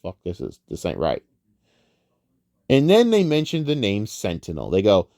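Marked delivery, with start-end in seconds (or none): 0.0-1.2 much lower and softer, thinking to himself; hushed confused aside; muttered inner thought. Retold or imitated. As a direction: mutter it under your breath like a private realisation. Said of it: "He's like, think like".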